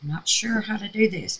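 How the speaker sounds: ordinary talk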